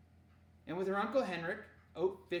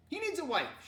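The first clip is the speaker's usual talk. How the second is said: high, loud fussing mother; exasperated complaint; 13 semitones higher, 5 dB louder than usual. Acted. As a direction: complain about him, pitched up. Mother scolding in story.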